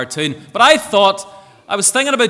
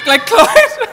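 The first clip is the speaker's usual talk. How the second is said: "Like clowns?" in a very high, loud, amazed squeal; incredulous squeak; 8 dB louder than usual. Real, not acted. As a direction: squeal it back in amused disbelief. Incredulous repeat of answer.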